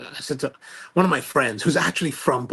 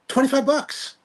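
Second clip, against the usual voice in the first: excited; 11 semitones higher, 5 dB louder than usual. Real, not acted. Excited about a bargain price.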